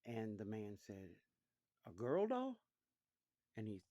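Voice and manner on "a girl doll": surprised question. Acted. Father surprised at the gift.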